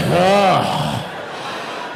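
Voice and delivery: imitated heavy exhale; big mock stressed-out sigh; deep breath. Comic mimics a stressed husband's deep breath, sighing. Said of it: "took a deep breath".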